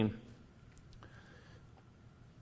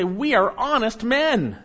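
slow, much higher, louder mocking echo; mock their pious claim; 10 semitones higher, 5 dB louder, slower than usual. Preacher mocking the brothers' claim, high and slow.

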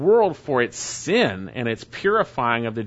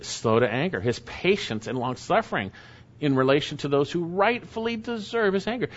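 normal talking voice

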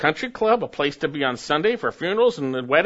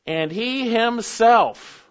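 slow, higher "And he himself", pressing on "himself"; emphatic reading; 6 semitones higher, 4 dB louder, much slower than usual. Shown as a demonstration. Preacher reading scripture aloud slowly, pressing on "himself". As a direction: draw out "And he himself" for weight. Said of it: "reading the verse emphatically".

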